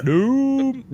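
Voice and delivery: put-on high, loud drunken "Doom"; drunk college kid. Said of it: "You guys say it like a drunk college kid".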